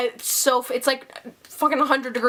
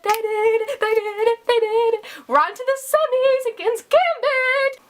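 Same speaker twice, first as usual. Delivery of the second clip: giddy cheering; 9 semitones higher than usual. Real, not acted. Fan celebrating team win.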